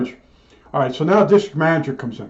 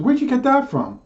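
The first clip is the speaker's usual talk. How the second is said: feigned surprise; 9 semitones higher than usual. Retold or imitated. Feigning innocence to district manager.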